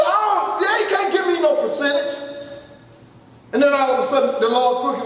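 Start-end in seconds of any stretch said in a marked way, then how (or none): 0.0-2.2 high-pitched, insistent retort; retold outraged objection; indignant refusal. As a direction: push back in disbelief, voice raised in pitch. Acts his indignant rejection of prognosis.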